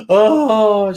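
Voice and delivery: loud, high mock wail; imitated crying. Woman hearing why the missionaries came, imitated loud sobbing. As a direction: burst into pretend tears, high and loud. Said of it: "she starts crying".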